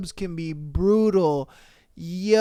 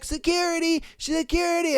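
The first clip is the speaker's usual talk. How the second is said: mock call for security; 7 semitones higher, 4 dB quieter, faster than usual. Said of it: "called over security".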